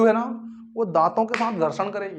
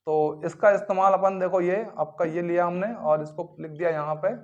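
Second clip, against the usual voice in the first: normal talking voice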